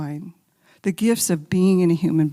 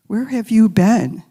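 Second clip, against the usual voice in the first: pleading cry; 4 semitones higher, 10 dB louder than usual. Retold or imitated. Angry prayer to God.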